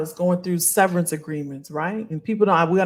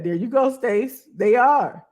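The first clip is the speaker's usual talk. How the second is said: usual speaking voice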